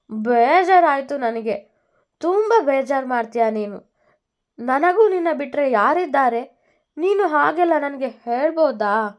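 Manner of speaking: lightly voiced wounded husband; hurt and sad. A narrator's reading. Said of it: "ಬೇಜಾರಲ್ಲಿ ಹೇಳಿದ್ದ ವೇದ". (ಸ್ವಲ್ಪ ಬೇಜಾರಿನ ಧ್ವನಿ)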